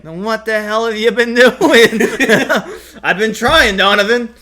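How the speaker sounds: loud high lines through laughter; laughing while voicing both.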